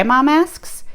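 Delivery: usual speaking voice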